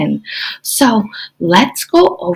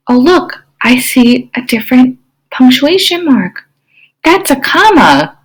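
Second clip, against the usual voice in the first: ordinary talk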